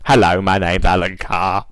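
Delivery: put-on a loose take on Alan Carr's accent; a hint of the Alan Carr accent, slightly mocking; Alan Carr impression. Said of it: "Hence his accent".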